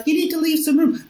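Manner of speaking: put-on high cooing baby talk; baby talk to dog. Mocking dog owner on a pathway, high baby talk. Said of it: "the baby talk to a dog".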